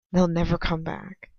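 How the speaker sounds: usual speaking voice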